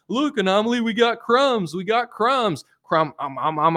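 high, goofy mock believer; mocking conspiracy believer. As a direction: play a dopey fan bragging about clues. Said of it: "following the cookie crumb trail".

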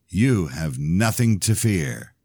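usual speaking voice

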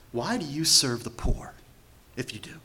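preacher whispering a probing question to the congregation. Real, not acted. Preacher drops to a searching whisper.

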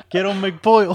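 loud, high mock ordering voice; hammed-up order at the counter; Cuban customer ordering. A friend mocks a customer's order, loud and high. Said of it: "Or a McPollo".

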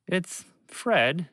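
ordinary delivery, nothing marked

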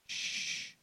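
soft, low shushing; hushing a child. Acted. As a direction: shush a fussing toddler softly. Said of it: "all along the man is saying".

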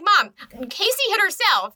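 high-pitched acted tattling; high, urgent retold complaint; mock protest. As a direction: imitate a teen appealing to mom.

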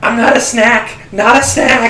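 high, loud panicked cries; panicked shriek. Acted. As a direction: shriek it like you're being eaten. Character panics while being devoured.

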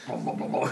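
low rumbling booms; imitated engine sound.

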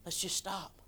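sly inner voice whispering to give up; tempting whisper. Acted. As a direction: whisper it like the flesh luring you to quit. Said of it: "Old flesh might start screaming".

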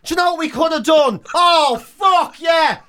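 usual speaking voice

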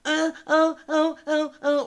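high-pitched repeated fake moans; exaggerated falsetto bleats of pleasure; mock cranky-bottom moaning. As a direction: imitate rhythmic whiny moaning, "oh, oh". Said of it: "cranky bottom who's like".

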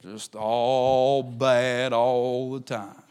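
very slow, droopy Eeyore-like moan; a hint of mopey Eeyore, very slow. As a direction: drag it out like gloomy Eeyore. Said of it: "the Eeyore syndrome".

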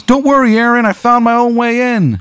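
very high, squeaky little bee; chirpy high insect voice; tiny bee voice. Acted. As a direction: pitch way up like a tiny bee talking. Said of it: "and he goes".

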